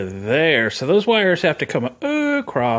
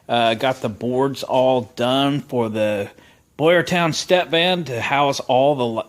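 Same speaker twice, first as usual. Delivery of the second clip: ordinary talk, nothing marked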